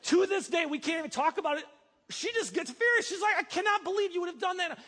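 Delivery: animated retelling. Retold or imitated. Retelling wife's fury.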